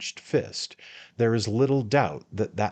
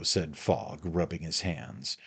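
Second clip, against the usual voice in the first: ordinary delivery, nothing marked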